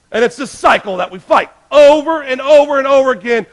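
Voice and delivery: loud, high, driving preacher's cry; preaching at full force. Real, not acted. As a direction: hammer the repetition home from the pulpit.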